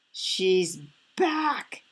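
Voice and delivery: put-on mild baby bear wail; a touch of cub-like alarm. A narrator's reading. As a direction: wail it in mock dread. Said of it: "he wailed".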